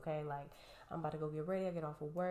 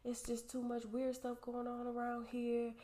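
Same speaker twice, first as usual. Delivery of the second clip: complaining neighbor; 7 semitones higher than usual. Retold or imitated. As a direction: grumble to her husband in a raised voice. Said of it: "and she's like".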